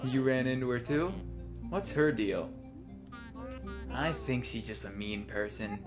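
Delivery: softly reading dialogue lines aloud; reading game dialogue. Acted. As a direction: read the lines like a script. Reads both characters' dialogue.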